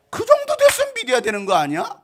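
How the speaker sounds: very high, loud reproach; exasperated scolding. Acted. Preacher scolding his flock for not believing, exasperated. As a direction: burst out in exasperation. Repeats the reproach at full force.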